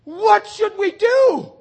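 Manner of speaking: high, loud anguished cry for help. Retold or imitated. Guilty man crying out to Peter, loud and desperate. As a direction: plead to the crowd at full voice. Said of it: "one of them cried out".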